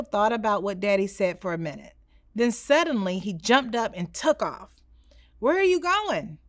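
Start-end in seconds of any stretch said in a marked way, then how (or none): 5.4-6.4 yelling after him. Acted. Father to son running away, a mild raised call. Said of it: "Daddy yelled after him".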